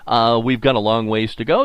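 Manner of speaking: usual speaking voice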